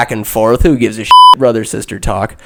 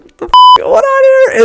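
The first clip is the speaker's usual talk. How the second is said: squeaky, much higher startled cry; mock shriek; 3 dB louder than usual. Evie stuck on the bouncing ladder, shrieking. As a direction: panic as the ladder wobbles. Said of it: "she's just kinda like".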